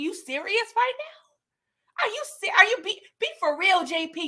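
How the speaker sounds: can't believe what he just heard; stunned disbelief. Retold or imitated. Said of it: "Tommy said the same thing I said".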